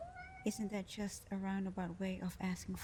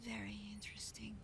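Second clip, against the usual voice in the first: softer, faster murmured aside; hushed intrigue; 9 dB quieter, faster than usual. Acted. Genie intrigued by the mortal, whispering it. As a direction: savour the word in a hush.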